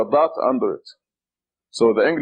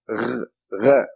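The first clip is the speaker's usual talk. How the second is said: throaty gargled gh; gargling sound; 2 semitones lower than usual. Shown as a demonstration. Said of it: "It's a gargling sound".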